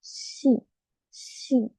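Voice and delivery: "Shi" said with a soft hushing sh, quiet and breathy; teacher modelling "Shi"; pronunciation demo. Shown as a demonstration. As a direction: model "Shi" slowly as a shh plus i. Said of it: "Es como la pronunciación para decir ella en inglés".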